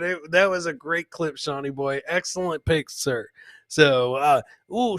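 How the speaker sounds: normal talking voice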